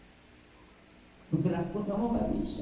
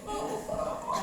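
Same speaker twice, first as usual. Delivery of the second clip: high mocking question; 13 semitones higher, 4 dB quieter than usual.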